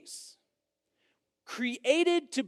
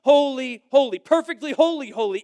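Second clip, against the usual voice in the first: emphatic; 3 semitones higher, 7 dB louder than usual. Retold or imitated. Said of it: "That's what they're saying".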